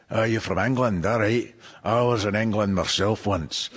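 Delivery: friendly Paisley drinker, lightly voiced; local pub regular. Pub regular greets the English visitor in a light Paisley accent. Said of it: "he said".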